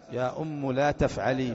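slow "أم" ending in u; damma on mim. Shown as a demonstration. Teacher repeats the example after parsing, slow "أم" as ummu. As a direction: read "أم" slowly as ummu. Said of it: "بضم الميم".

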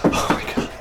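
breathless horrified whisper; whispered terror. Acted. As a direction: gasp it out, horrified. Fleeing the cult.

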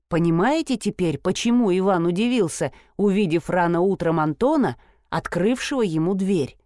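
ordinary delivery

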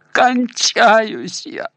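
slow, high, strained moan; dying gasp. A narrator's reading. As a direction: drag it out like a dying man. Dying thug cries out. (стон умирающего)

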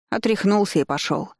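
ordinary delivery, nothing marked

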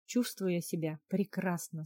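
ordinary delivery

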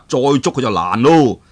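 usual speaking voice

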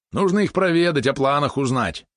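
lightly dreamy, high-pitched musing. A narrator's reading. Valeron plans a visit to the neighbours, mildly dreamy. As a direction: voice a wish with a touch of dreaminess. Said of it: "мечтательно сказал Валерон".

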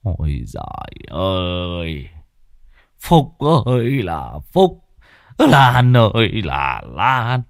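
put-on slow, breathy old grandfather lamenting; feeble wheezing old man. A narrator's reading. Sick grandfather laments to his daughter and son-in-law, slow feeble wheeze. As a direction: sigh out each word like a sick old man. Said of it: "thều thào".

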